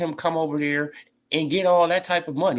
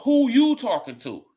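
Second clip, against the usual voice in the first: slow challenge; 7 semitones higher, 3 dB louder than usual. Shown as a demonstration. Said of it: "That should be the first thing out your mouth".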